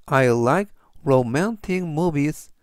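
very slow, careful English; slow model sentence. Shown as a demonstration. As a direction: read it slowly for learners. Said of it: "전체적 의미는". (아주 천천히 또박또박 읽는 예문)

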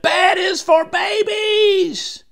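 high, loud, drawn-out protest; defiant wail. A narrator's reading. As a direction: cry it out slowly like a protesting child. Child protesting bedtime.

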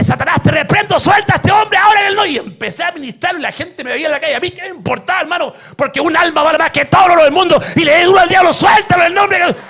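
loud, high commanding rebuke of Satan; shouted exorcism. Retold or imitated. Pastor casting out a demon in the street, shouting. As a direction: cast the devil out with full force.

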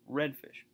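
RED stressed, fish left weak; trochee stress. Shown as a demonstration. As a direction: lean on "red" and let "fish" drop. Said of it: "trochee would be".

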